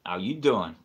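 low-voiced catchphrase imitation; playful low pick-up line; Joey. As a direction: drop the voice for a come-on. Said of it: "As Joey in nineteen ninety-five used to say".

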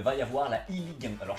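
usual speaking voice

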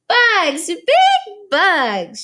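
put-on high, loud toddler squeal of joy; a little girl crying out in delight; excited toddler cry. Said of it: "she cried".

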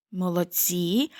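ordinary talk

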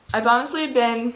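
talking through a mouthful of food; words round a big bite; mouth full. Real, not acted. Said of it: "sorry, I have to my mouth full".

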